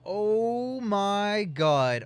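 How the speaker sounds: slow, stretched oh my god; drawn-out disbelief. Real, not acted. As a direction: drag it out in disbelief.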